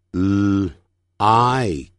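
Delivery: like built slowly from a held L, then blended; slow phonics demo of like; sounding out. Shown as a demonstration. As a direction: split like into L and ike, then say it whole. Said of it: "我们就这样说".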